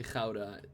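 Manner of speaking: Gouda with a throaty opening, KHOW-da; Dutch pronunciation. Shown as a demonstration. Correcting an American's cheese name, Gouda said the Dutch way. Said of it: "hearing an American say".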